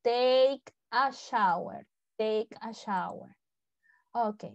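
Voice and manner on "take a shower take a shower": very slow "take a shower", each word stretched; pronunciation model. Shown as a demonstration. Teacher reminds students of pronunciation, slowly modelling "take a shower". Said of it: "no se olviden la pronunciación".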